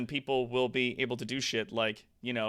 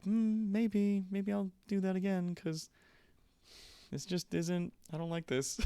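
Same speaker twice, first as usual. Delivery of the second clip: higher, softer musing to himself; half-tempted, trailing, higher and softer; wistful inner voice; 8 semitones higher, 7 dB quieter than usual. Retold or imitated. Part of him tempted back to village life, softer and higher. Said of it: "there's a part of me that's like".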